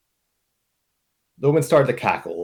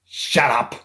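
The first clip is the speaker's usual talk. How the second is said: a louder snap in a put-on voice; snapped; 4 semitones higher, 4 dB louder than usual. The blonde captor snapping at the mercenaries, short put-on snap. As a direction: bite it off at them. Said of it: "snapped the blonde".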